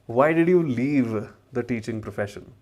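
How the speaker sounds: ordinary talk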